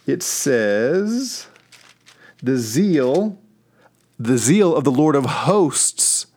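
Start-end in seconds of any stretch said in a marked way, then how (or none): 2.4-6.3 scripture reading. Acted.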